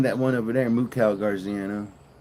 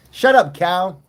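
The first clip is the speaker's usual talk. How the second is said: loud, higher shout at the cow; yelling at cow; 8 semitones higher, 10 dB louder than usual. Real, not acted. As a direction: bark it over the mooing. Yelling at noisy cows.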